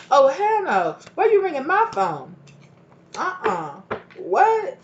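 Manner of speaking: mock a sassy person refusing a call; dismissive refusal. Voicing dismissive person on phone.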